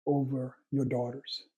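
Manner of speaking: normal talking voice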